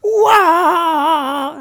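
very high, stretched cartoon wail; Mario character voice. Host opens his introduction with a Mario character's wail. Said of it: "another voice from a Mario character".

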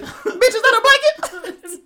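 very high, slow, laughing mimicry; exaggerated mock shout through laughter; imitating friend's outburst. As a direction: insist on the impression, loud and drawn out. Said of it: "You said it exactly like that".

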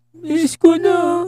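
put-on high, very slow little child plea. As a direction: whimper it like a sad small boy. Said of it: "Sabi niya".

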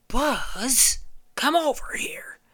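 high, secretive whisper; Woody whispering. Acted. Woody calling Buzz over, high conspiratorial whisper. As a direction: pull Buzz aside, hushed. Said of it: "And Woody's like".

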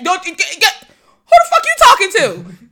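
yelling through a laugh; shrieking fury, half laughing; furious. Retold or imitated. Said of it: "try to sound less rageful".

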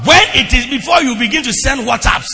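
loud, mocking the WhatsApp calls; preaching at full force. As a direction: belt the frantic "where are you" messages.

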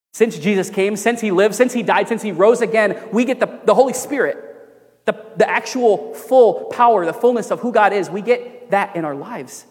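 rising, insistent preaching build; fervent sermon climax. Real, not acted. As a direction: drive it home like a preacher.

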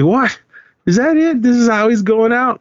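very high-pitched disbelief; retold reaction of shocked protest; incredulous outburst. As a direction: squeak it out in disbelief. Acting out his shocked reaction.

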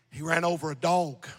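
ordinary talk, nothing marked

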